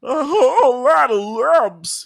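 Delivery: very high, loud, drawn-out cartoon puma; Pete Puma. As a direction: stretch it out in a huge cartoon wail. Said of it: "that was my best Pete Puma impression".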